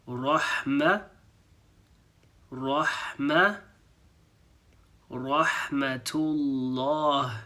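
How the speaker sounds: Rahmatullah drawn out very slowly, then broken into Rahma; slow demo. Shown as a demonstration. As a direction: build Rahmatullah up piece by piece. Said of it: "we just need to say".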